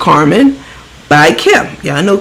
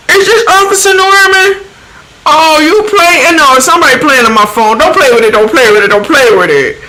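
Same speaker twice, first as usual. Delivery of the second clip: Carmen; 9 semitones higher than usual. Retold or imitated. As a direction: squawk it high, then rattle off the catchphrase. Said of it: "this is how she talks".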